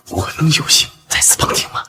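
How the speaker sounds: soft, whispery, deferential question; hushed request. Acted. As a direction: ask humbly under the breath. Fawning request to listen in. (小声恭敬地请求)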